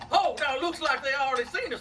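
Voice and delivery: ordinary delivery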